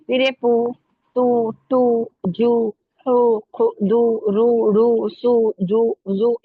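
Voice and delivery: each syllable sounded out loud and slow; drilled syllables. Shown as a demonstration. Teacher drilling Urdu syllables for students, loud and slow. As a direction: spell out the sounds for the class, drawn out. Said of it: "ये सब आ जाएगा".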